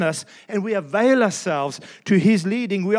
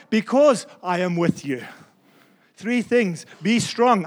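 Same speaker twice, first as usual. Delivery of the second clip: usual speaking voice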